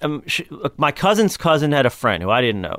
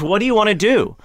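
retold exasperated question; 8 semitones higher than usual. Interviewer in stitches questions him.

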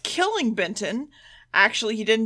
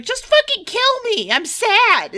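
high, louder mock wail of despair; hammed-up despairing cry; 12 semitones higher, 6 dB louder, slower than usual. Joe daring the Master to kill her, mock wailing high and loud. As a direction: throw it out like melodramatic despair.

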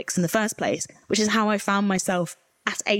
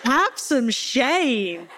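laughing exasperation; 5 semitones higher, slower than usual. Real, not acted. Venting at the BBC.

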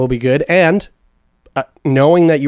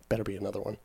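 ordinary delivery

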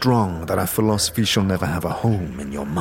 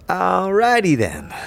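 drawn-out, much higher quip, slow; sidekick quip; 9 semitones higher than usual. Acted. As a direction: stretch it out high and mocking. Morte quips at silent dustman.

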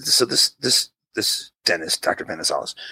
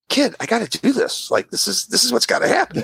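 raised-pitch, insistent explanation; pressing, higher-pitched retold voice; imitated exasperated dentist. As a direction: act out a dentist insisting to a kid. Dentist's reply to begging child, acted.

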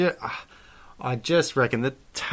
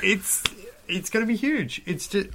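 voice cracking; 9 semitones higher than usual. Real, not acted. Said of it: "So good that your voice just broke".

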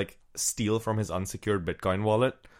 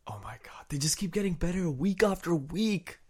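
whispered mock praise; co-host mocking the show's quality, whispering; sarcastic whisper; 6 semitones higher, 5 dB quieter than usual. Real, not acted. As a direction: breathe it out, deadpan sarcastic. Sarcastic aside.

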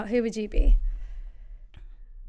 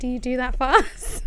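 ordinary talk

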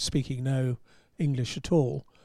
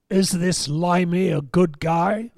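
A J Foyt's Texan voice; 3 semitones higher, 5 dB louder, slower than usual. Retold or imitated. Foyt on the phone sizing up the English journalist, slow Texan drawl. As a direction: growl it out slow like a gruff old Texan. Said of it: "A J Foyt's Texan voice saying".

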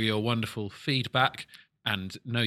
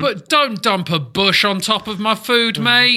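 indignant; 10 semitones higher, 9 dB louder, slower than usual. Real, not acted.

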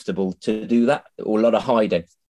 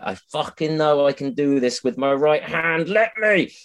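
higher, exasperated plea climbing to the end; frustrated protest; 5 semitones higher than usual. Acted. Right-hander made to draw left-handed, acting out frustrated protest. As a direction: plead in mounting frustration. Acted frustration at being restricted.

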